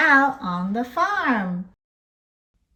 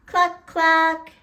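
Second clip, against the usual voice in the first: higher clucking; chicken cluck; 6 semitones higher than usual. Shown as a demonstration. Teacher clucking for little learners. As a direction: cluck like a hen.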